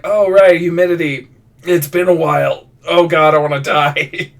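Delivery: mock dismay. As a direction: act out a miserable realization, playfully overdone. Relives stepping into humid heat.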